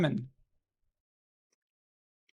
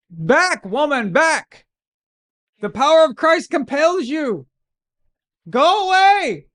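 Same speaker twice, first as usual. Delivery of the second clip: mock exorcist; 9 semitones higher, 3 dB louder, slower than usual. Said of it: "Minuteman says".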